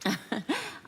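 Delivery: flattered laugh. Acted. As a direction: laugh it off, pleased. Amused by flirting remark.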